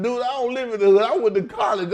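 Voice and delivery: high, fast, incredulous laugh through it; amused disbelief, words tumbling out; laughing exasperated retort. Retold or imitated. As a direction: push back laughing, sped up.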